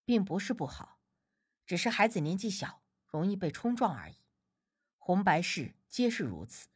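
low, soft, even answer; calm explaining. A narrator's reading. Healer answering grandma about weddings and funerals, calmly explaining.

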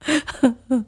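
high, stagey sobs; theatrical crying sound; acted sobbing. A narrator's reading. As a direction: trail off into mock weeping. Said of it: "放声大哭".

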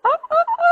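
three very high, loud, strained "啊" cries like a rooster crowing; teacher imitating a rooster crow to find the highest pitch; rooster crow imitation. Shown as a demonstration. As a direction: stretch your neck and crow "啊" at the top of your range. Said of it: "我们抻长脖子去".

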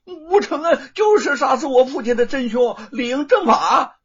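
loud, high, crying out his charge; righteous accusation. A narrator's reading. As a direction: hurl the accusation at the court. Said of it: "振振有词儿地说".

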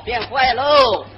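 slow, stretched-out gloomy tag; mock mournful drawl; drawn-out punchline. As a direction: drag the words out for the laugh.

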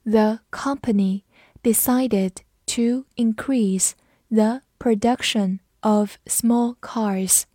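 slow, careful read for students to follow; each word laid out slowly and clearly. Shown as a demonstration. Teacher reading slowly for class to repeat, slow. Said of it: "跟着我慢读一遍".

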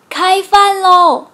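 slow, loud drawn-out call to dinner. A narrator's reading. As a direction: call everyone to eat from outside. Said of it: "只听熊爸爸喊". (拖长声音大声喊开饭)

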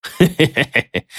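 high, sly heh-heh laugh; sly chuckle. A narrator's reading. Gang boss chuckles slyly at the cunning plan. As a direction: chuckle with wicked glee. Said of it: "点头笑了笑说".

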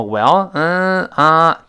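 musing "well, um, ah"; thinking aloud. Shown as a demonstration. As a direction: stall with "well" while working out what to say. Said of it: "就一边想一边说了".

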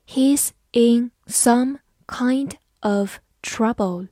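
slow, word-by-word sentence; slow reading. Shown as a demonstration. Teacher reading a sentence slowly for students to copy. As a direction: read it slowly so learners can follow. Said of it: "慢慢来读".

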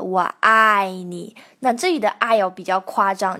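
ordinary talk, nothing marked

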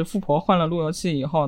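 normal talking voice

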